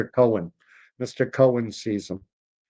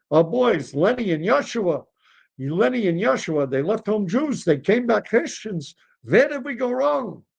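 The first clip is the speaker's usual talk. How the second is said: high, swooping complaint; lamenting fathers; 8 semitones higher than usual. Acted. Fathers telling mutual friend their trouble, lamenting. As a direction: bemoan it, rising toward a mild wail.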